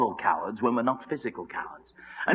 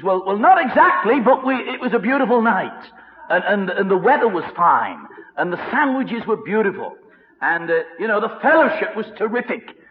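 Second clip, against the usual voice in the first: high-pitched, falsely upbeat waffling; acted sheepish cheeriness; evasive excuses; 6 semitones higher, 3 dB louder than usual. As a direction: dodge the question with hasty praise of everything else. Acted fisherman evading the question.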